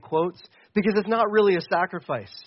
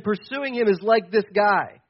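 usual speaking voice

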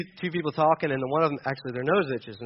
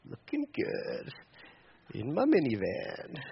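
slow, breathy mock-admiring murmur at his own reflection; smug self-admiration; 4 semitones lower, 9 dB quieter, slower than usual. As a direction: savour it like a proud minivan dad. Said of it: "and I'm like".

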